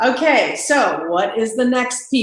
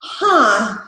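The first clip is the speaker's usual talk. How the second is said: bored distracted rep; 7 semitones higher than usual. Shown as a demonstration. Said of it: "And then they're like".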